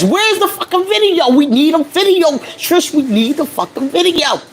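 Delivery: high-pitched mock demand. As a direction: pitch it up and plead insistently for it. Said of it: "What did Trish say?".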